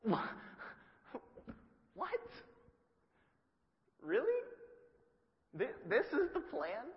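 high disbelieving squeak with a laugh; can't believe this is the plan; incredulous Peter. Retold or imitated. Peter baffled by Jesus leaving, high incredulous laugh.

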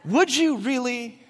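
slow, high, coy protest; mock flattered. Ephron coyly protesting to Abraham, slow and high. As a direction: brush off the offer with fake modesty. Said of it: "He's like".

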